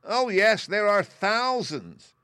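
high, loud, slow squeaky boast; proud mouse queen. A narrator's reading.

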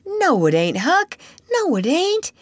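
high, emphatic denial; insistent. Acted. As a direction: cut him off, pitch jumping up. Tom insists against Huck.

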